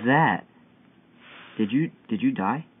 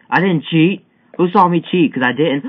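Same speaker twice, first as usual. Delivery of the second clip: higher, louder protest of innocence; defensive insistence; 7 semitones higher, 10 dB louder than usual. Real, not acted. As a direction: insist loudly you didn't cheat. Denies cheating twice, louder.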